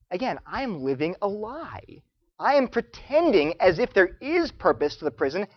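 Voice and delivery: usual speaking voice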